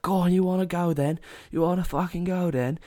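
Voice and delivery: egging someone on to fight, hammed up; mock challenge. To brawling drunks outside the club, a goading mock taunt. Squaring up to rival clubgoers.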